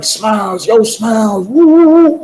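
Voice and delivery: high, hooting imitation of calls; inmates calling out. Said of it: "they was banging like".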